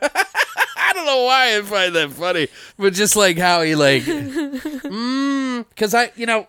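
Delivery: high, laughing through words. Real, not acted. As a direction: giggle as you talk.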